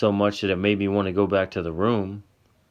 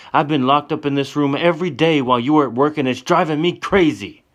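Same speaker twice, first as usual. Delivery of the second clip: high, loud, exasperated; loud, desperate complaint; heated pleading; 7 semitones higher, 6 dB louder than usual. Acted. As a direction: let the frustration pour out. Tessa's heated argument continues.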